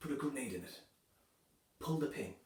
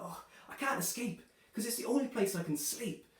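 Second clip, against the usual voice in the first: whiny complaint; 8 semitones higher than usual. Retold or imitated. Husband complains about wife's moaning.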